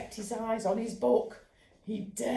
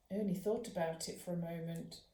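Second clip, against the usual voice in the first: plain reading voice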